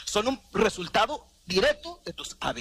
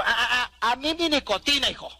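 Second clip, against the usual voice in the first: addicted body; 6 semitones higher than usual. Retold or imitated. The addicted body refusing the smoker who wants to quit, mock scolding. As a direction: tease the smoker possessively. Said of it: "¿Y qué te dice el cuerpo?".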